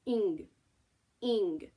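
"Ing" as one blended sound, drawn-out ng; spelling sound. Shown as a demonstration. Teacher answering what I, N, G make, blended spelling sound. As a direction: sound out "Ing" as a single unit. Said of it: "what sound do they make".

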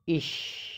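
ish spoken as a soft whispered sh sound; demonstrated ish. Shown as a demonstration. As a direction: breathe out a quiet ish, barely voiced. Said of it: "I C H sound is".

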